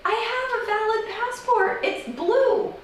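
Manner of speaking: very high-pitched insistence; exasperated, voice way up high; indignant protest. Acted. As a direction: protest your citizenship in a squeak. Protests threatened deportation.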